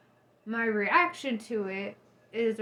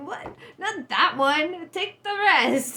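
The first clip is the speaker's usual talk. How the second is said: high, slow mock whine of disbelief; 7 semitones higher, 6 dB louder, slower than usual. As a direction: stretch it out high and slow, half-laughing at the absurdity. Mock client picking the wrong card.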